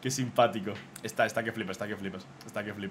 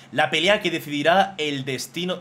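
dramatic title read; 2 semitones higher, 4 dB louder than usual. Acted. Reads drawing title dramatically.

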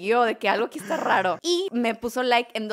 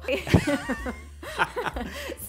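bright rising laugh at herself; real laugh; 6 semitones higher than usual. Real, not acted. She laughs at her own broke moment, rising laugh. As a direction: laugh it off, pitch climbing.

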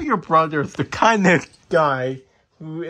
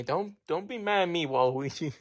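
ordinary talk, nothing marked

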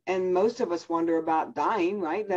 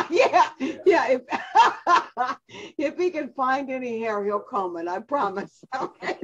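laughing hard through the words, high-pitched; helpless laughter breaking up the words; 7 semitones higher, slower than usual. Real, not acted. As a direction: tease through real laughter. Teasing husband about his hair.

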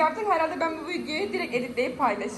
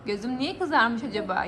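normal talking voice

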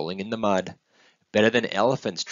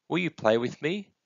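put-on high child's voice asking; small, high-pitched question; hopeful little one; 8 semitones higher than usual. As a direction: plead lightly for a playmate. Lonely little one asks for playmate.

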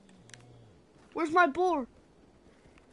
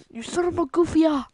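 repeated mock yell; 6 semitones higher, 8 dB louder, faster than usual. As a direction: yell it again, less force. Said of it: "He's like".

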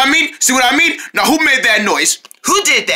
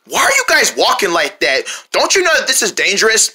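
ordinary talk

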